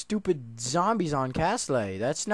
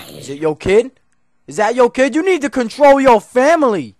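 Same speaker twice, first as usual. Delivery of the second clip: mock scolding; 8 semitones higher, 12 dB louder than usual. Real, not acted. Scolding a mob.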